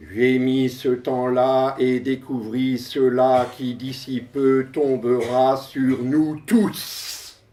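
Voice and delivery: measured, emphatic recitation with pauses; poem declamation. Acted. Poet reads verse about computers to an audience, declaimed.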